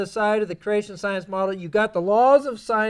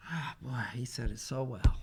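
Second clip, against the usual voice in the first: mock awed believer; 9 semitones lower, 11 dB quieter than usual.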